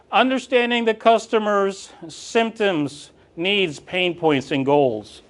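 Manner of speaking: slow, deliberate list of points; slow emphatic list. Shown as a demonstration. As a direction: spell out each item slowly. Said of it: "Number one".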